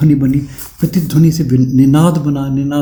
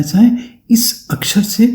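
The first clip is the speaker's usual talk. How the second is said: whispered sae; 7 semitones higher, slower than usual. Shown as a demonstration. Teacher whispering the sound of a conch. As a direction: whisper साए slowly. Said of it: "होती ना साए".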